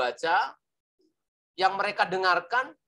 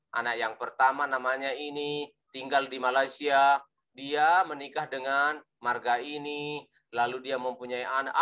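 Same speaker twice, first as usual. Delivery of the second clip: low, level drone with no lift; demonstrating a boring flat delivery; boring monotone; 4 semitones lower than usual. Shown as a demonstration. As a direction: recite the family tree tediously in a dull monotone. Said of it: "Sangat membosankan ya".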